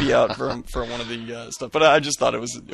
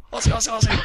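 high-pitched mock gamer chant; imitated battle cry; 10 semitones higher, much faster than usual. Host imagines the listener entering a game, high mock battle cry. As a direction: chant it high through a laugh. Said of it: "As soon as he gets in the game".

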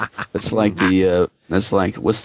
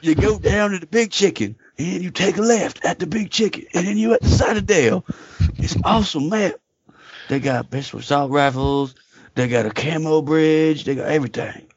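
put-on country voice, high then easing down; Southern yokel; 6 semitones higher than usual. Local showing off the awesome map, hammed-up Southern voice. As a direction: give directions like a mock Southern local.

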